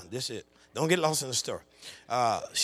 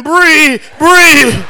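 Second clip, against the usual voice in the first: put-on crying out to baby; 11 semitones higher, 21 dB louder than usual. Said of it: "I'm on the couch crying".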